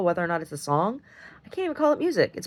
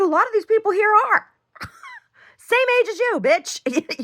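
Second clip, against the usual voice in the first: high, loud, gleeful retold jab; cheeky high comeback; 5 dB louder than usual. To the stunned mother on the balcony, high mocking triumph. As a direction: toss it at her like a triumphant gotcha.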